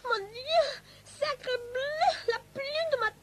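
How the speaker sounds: hammed-up French accent, high and excited; French maid. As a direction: overplay a French maid's gasps in a French accent. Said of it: "Fifi the French maid".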